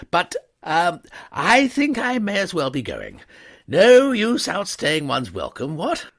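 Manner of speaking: high, loud, bluffing gentleman; nervous upper-class chatter; evasive young toff. A narrator's reading. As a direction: cover the embarrassment with bluster. Wooster voiced by narrator.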